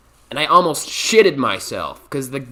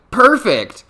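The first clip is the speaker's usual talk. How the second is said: high, louder chirpy Perfect; eager agreement; 10 semitones higher, 7 dB louder than usual. Retold or imitated. New hire answering the interviewer, overly eager Perfect. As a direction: sell it brightly, not listening. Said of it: "And I was like".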